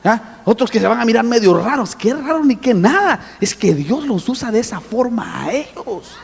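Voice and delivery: normal talking voice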